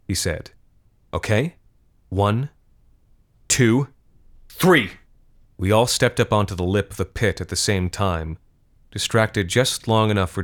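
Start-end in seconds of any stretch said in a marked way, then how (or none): 1.1-4.9 building countdown. Acted. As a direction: count off, rising higher and louder to three. Counting down to a look.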